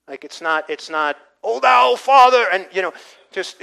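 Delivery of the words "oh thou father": loud, high, hammed-up King James prayer voice; mock showy prayer. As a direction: ham up a pompous preacher's prayer, much louder and higher. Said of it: "it's not putting on a show".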